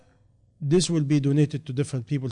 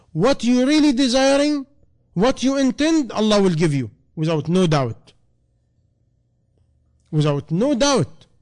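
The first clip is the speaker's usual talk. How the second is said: high, loud, insistent preacher's call; impassioned sermon peak; fervent preaching; 8 semitones higher, 6 dB louder than usual. Acted. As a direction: drive it home to the congregation. Preacher pressing the point.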